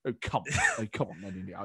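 lightly voiced mock-incredulous politician; muttering, mock-incredulous brush-off; Boris. Impressionist replying to a question put to him, mock incredulity. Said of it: "that sort of mock incredulity".

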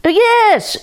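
high, loud yelled yes; a fox yelling over his shoulder. Acted. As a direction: shout back while fleeing. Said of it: "yelled the fox".

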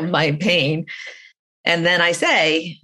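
ordinary talk

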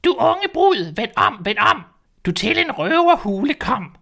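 high, loud sing-song bird cry; shrill warning call of a caged bird. Acted. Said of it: "en stemme råbe".

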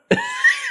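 short high-pitched laugh; high giggle. Real, not acted. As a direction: squeal a quick laugh. Laugh after calling her a crone.

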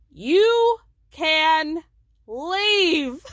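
mock shouted leave. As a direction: bellow the leave out.

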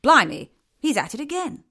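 chimp quote. Retold or imitated. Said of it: "chimps at the zoo that would be like".

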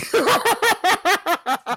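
loud, high burst of laughter; high shrieking laugh. Real, not acted. Laughs at her own joke.

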